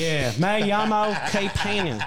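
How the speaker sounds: put-on slow, clumsy American accent on Spanish; lightly hammed-up gringo Spanish, drawn out; Randy Moss Spanish. Telling friends his Spanish is bad, clumsy American-accented Spanish. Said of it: "I sound like Randy Moss speaking Spanish".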